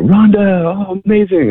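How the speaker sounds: light up at the sight of her; joyful welcome. Retold or imitated. Missionaries greeting Rhonda after months, high delighted welcome. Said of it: "shut, you know".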